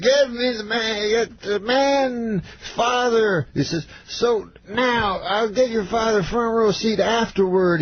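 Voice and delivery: grand star giving orders; Cosby impression. To his agent about Norm's dad, slow mock Cosby.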